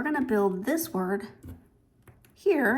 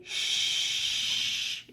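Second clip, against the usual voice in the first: long hushing "shh"; drawn-out unvoiced shush; librarian's shush. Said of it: "the librarian might say".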